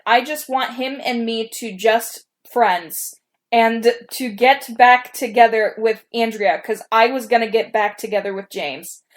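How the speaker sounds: slow, careful reading; embarrassed reading aloud. Real, not acted. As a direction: read it slowly, a bit sheepish. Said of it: "this is what I said in my story".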